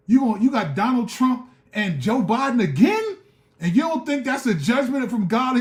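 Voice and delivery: mocking the election as judgment, loud incredulous; exasperated rant. Real, not acted. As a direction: throw it out in disbelief.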